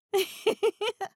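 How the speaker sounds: high loud prankster laugh; gleeful guilty cackle; mischievous laugh. Acted. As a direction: laugh at your own prank.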